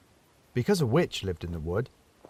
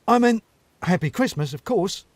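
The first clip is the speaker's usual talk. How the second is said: flustered stammer; 8 semitones higher, 6 dB louder than usual. Acted. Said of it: "stammered Urchin".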